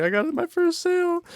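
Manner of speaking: very high, squealing with joy; excited squeal. Retold or imitated. Salesman celebrating his first sale, squealing high with joy. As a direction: squeal it like you just won. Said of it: "and I was so happy".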